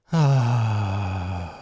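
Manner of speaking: loud, open sigh falling away slowly; long sigh. Shown as a demonstration. Guide shows listeners how to relieve leftover strain, long sigh. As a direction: let it all out in one long "ahh". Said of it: "let out a nice long sigh".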